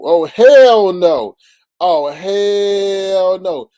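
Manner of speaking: drawn-out loud, high oh hell no; outraged hell no. Real, not acted. Streamer reacting to a viewer's name change, slow loud outrage. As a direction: stretch it out slow and loud in disbelief.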